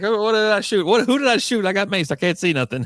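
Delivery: high, loud, flustered mimicry; mock panic. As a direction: imitate a blinded man panicking. Acts out maced cop's panic.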